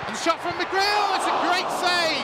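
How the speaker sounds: loud, rising call of the save; thrilled shout; excited call. Real, not acted. Shot and save, live call.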